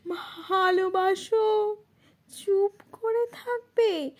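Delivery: high, drawn-out tearful calling; anguished cry out. Acted. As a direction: cry it out in grief. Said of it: "চিৎকার করে বলি". (আর্ত চিৎকারে, কান্নাভেজা গলায়)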